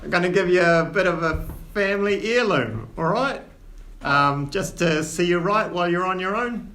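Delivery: lightly voiced Cockney dwarf dad, scolding but fond; dwarf father in Cockney. Old dwarf father chiding his son, lightly Cockney.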